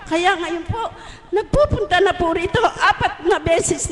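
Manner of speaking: laughing joyfully through the words. Real, not acted. Mother laughing to the congregation about her son returning. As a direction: giggle as you tell the good news.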